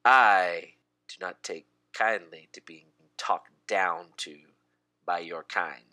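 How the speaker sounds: low, measured, halting disdain; affronted elf. Acted. Elf to the tree elf who insulted him, affronted. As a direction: drop low and pause between phrases, offended. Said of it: "and goes".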